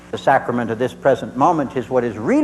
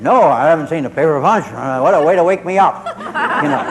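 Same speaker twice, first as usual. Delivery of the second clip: grumpy woken son; 3 semitones higher, 5 dB louder than usual. Retold or imitated. Woken son complains, acted out.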